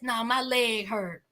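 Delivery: a low, mumbled "No, my leg hurt"; lazy excuse. Retold or imitated. Julius dodging the producers' question with a lazy, low excuse. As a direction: throw the excuse away, low and quiet. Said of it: "And Julius says".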